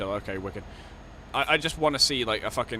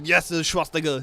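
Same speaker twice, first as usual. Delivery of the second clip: louder, higher put-on Arnold; 5 semitones higher, 5 dB louder than usual. Arnold returns to the call, loud put-on voice. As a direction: boom it like Arnold. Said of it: "And he goes".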